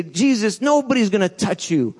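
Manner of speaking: hammed-up promise of loyalty; mock excited vow. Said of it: "I could be excited and say".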